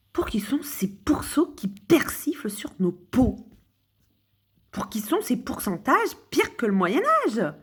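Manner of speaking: slow, high whispered verse; whispered recitation. Pretend. Performing her poem for viewers, slow whisper. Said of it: "on refait les pourceaux".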